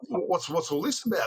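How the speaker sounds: put-on high-pitched puzzled boy; retold puzzlement. Storyteller voicing a boy who just woke up, puzzled. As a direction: ask it high, imitating a baffled kid.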